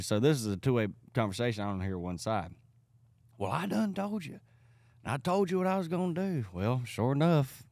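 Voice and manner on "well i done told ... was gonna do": high, far-off country voice of the angry neighbor. Retold or imitated. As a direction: play the neighbor shouting down the phone line.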